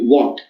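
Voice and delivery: "walked" as one syllable ending in T; T-sound ending. Shown as a demonstration. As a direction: say "walked" like walkt. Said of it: "make this E D sound as the T sound".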